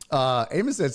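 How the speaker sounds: ordinary delivery, nothing marked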